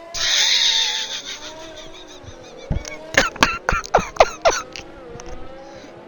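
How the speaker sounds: long, drawn-out helpless laugh. Real, not acted. Streamer realising it wasn't a rage quit, helpless laughter. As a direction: burst out laughing and keep it going.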